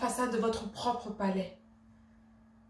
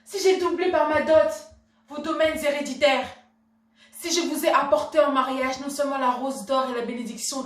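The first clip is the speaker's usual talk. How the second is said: loud, high, ringing indignation; impassioned outburst; 4 semitones higher, 6 dB louder than usual. Acted. Wife urging her husband to get angry for her, impassioned outburst. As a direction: burst out and goad him hard.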